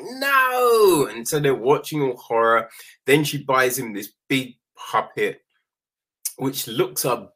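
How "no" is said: high, drawn-out mock "no"; hammed-up high, falling denial; dismissive mum. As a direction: imitate a mum loudly waving off a worry. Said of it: "And she's like".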